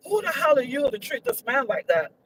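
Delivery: normal talking voice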